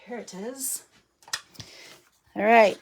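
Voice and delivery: ordinary talk, nothing marked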